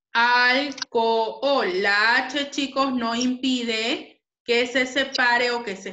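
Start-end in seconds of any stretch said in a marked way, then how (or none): 0.1-1.8 Alcohol stretched into al, co, hol, very slowly; syllabified slowly. Shown as a demonstration. Teacher showing the class how alcohol separates, slow. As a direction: pull Alcohol apart syllable by syllable. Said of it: "Se separa así".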